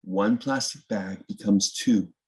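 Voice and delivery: usual speaking voice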